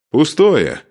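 high, loud shout. A narrator's reading. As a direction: shout it across the field. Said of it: "крикнул Машек".

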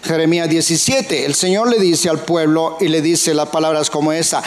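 normal talking voice

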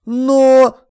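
loud, high drawn objection; attempted protest. A narrator's reading. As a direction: try to break in. Man cut off while protesting.

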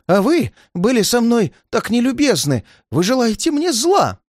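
put-on high-pitched, agitated old man; wounded, heated old man; heated accusation. A narrator's reading. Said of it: "горячился старик".

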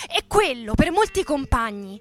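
usual speaking voice

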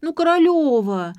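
high loud whiny call; whining. A narrator's reading. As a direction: whine her name. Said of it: "ныла Нелли".

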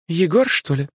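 put-on friendly older woman voice; директриса, добрая. A narrator's reading. Director voices kindly. (добродушная директриса)

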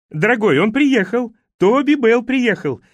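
high, loud call through the house. Acted. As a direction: call excitedly to someone in another room. Wife calls out to husband in house.

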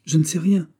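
breathless with dread; anguished. Acted. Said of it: "une palpitation de l'angoisse". (avec angoisse, voix aiguë et plus forte)

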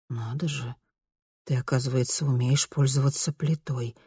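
quiet, doubtful murmur; distrustful mutter. A narrator's reading. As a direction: mutter it in disbelief. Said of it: "недоверчиво пробормотала она".

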